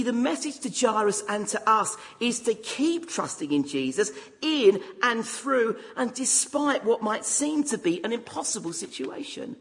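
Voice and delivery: ordinary talk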